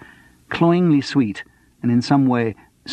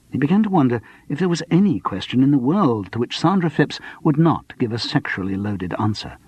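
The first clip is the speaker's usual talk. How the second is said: ordinary delivery, nothing marked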